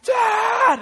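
imitated child's scream for a parent; very high, loud, drawn-out yell; top-of-voice scream. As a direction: scream it like a son calling from upstairs. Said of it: "scream at the top of his voice".

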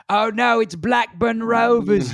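mock English football fan, high and loud; a hammed-up British yob accent; cheesy Brit accent shouted at a rival fan; bad British accent. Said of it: "bleep the entire accent portion of that 'cause it's not good".

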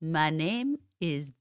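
soft, slow, hesitant French accent. Shown as a demonstration. Co-host asked to do an accent, tentative French accent. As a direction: try a French accent, quietly and carefully. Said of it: "Have a French accent".

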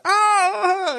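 high mock wail of a crying bully; hammed-up crying yelp. Bully after getting hit, mock crying wail. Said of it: "goes like".

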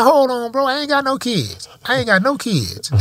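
high, emphatic mock denial; retold denial. Denying the kid, retold.

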